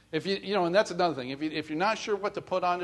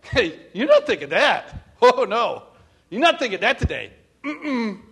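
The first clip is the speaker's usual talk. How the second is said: scolding own thoughts; 5 semitones higher, 6 dB louder than usual. Pretend. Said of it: "And you know what you say?".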